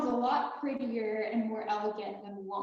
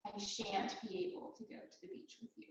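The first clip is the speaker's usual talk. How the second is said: a softer, more refined take of the line; elegant and classy; 10 dB quieter than usual. Shown as a demonstration. To a friend, turning down the beach trip, softly and elegantly. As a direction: make it sound classier by saying shan't. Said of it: "Much classier".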